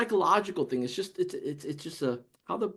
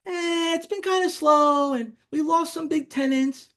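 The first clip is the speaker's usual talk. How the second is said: voicing Jane; 9 semitones higher, slower than usual. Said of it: "Jane answers the salesman".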